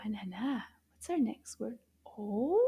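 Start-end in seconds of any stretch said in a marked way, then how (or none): none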